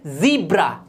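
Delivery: ज़ीब्रा with long ee, "ZEE-bra"; long first vowel in ज़ीब्रा; correct pronunciation. Shown as a demonstration. Teacher models for learners, ज़ीब्रा said "zee-bra". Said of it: "ये होता है".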